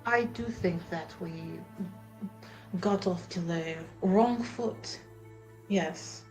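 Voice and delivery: normal talking voice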